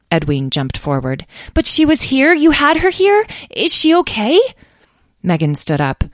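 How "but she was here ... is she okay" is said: put-on very high, loud child's voice; high-pitched excited pleading; anxious, eager questioning. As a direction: burst in with urgent questions. Young dragon anxiously asks after sister.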